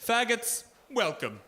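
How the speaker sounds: lightly voiced tough guy, slow on the last bit; a hint of the ad mobster signing off; mobster pitchman. Imagined restaurant ad guy closing, lightly voiced. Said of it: "Sopranos kind of guy".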